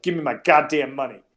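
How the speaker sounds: loud, threatening tough guy; menacing thug. Retold or imitated. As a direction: growl it like a debt collector. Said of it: "and he was like".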